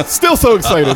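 high, laughing and giddy; laughing excited. Real, not acted. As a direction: laugh through it, mock eager. Third try, laughing, much higher.

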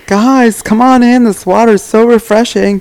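imitating Cooper. Friend calling from the glacial river, high hammed-up invitation. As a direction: squeak it out like a giddy friend waving everyone into the water. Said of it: "And he's running, going".

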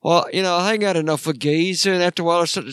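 mock Ozzy British accent. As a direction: chat like [name] in a mild English accent. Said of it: "I came from the south side of the Bronx of England".

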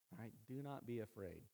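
low, soft and soothing; quiet reassurance. Retold or imitated. Preacher repeating Jesus's words to the fearful, softly reassuring.

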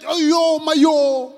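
loud pained cry of oh yo; anguished cry. Retold or imitated. Preacher voicing Jesus' possible cry, loud anguished wail. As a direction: cry it out in agony. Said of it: "He could have said".

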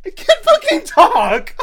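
high shrieking laughing cry; laughing yell. Real, not acted. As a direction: shout it while cracking up. Laughing at own stutter.

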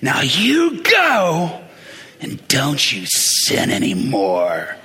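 put-on slow, stern old Pentecostal granny, dragging each word. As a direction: wag your finger like a strict holiness granny. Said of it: "sort of shaking and saying".

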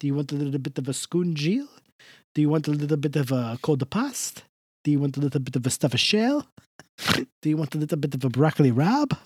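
put-on thick Jersey Italian accent; Italian-American accent. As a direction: play a warm old Italian uncle. Held Italian-American character voice.

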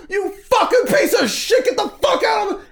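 high, loud, furious screech; screaming bus driver. Retold or imitated. Bus driver screaming at the film crew, shrill furious yell. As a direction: explode like a raging driver. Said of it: "And the bus driver's like".

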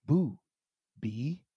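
two words set well apart; slow, separated syllables, pitch dropping on the second; slow teaching. Retold or imitated. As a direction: spell it out slowly for a toddler, long pause between. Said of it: "So what you say is".

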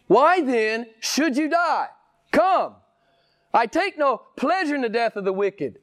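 pleading preacher. Real, not acted. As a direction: plead with sinners to come, pitched high. Preacher pleads in God's words.

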